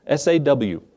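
saw spelled letter by letter, S A W, slowly; S A W said as three slow letters; spelling it out. Shown as a demonstration. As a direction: spell out S A W with a small laugh. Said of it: "And it says when she saw".

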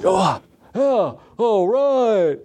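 high mock-excited cheer; mock thrill. Angler landing one fish, hammed-up high excitement. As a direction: ham up the joy of a catch.

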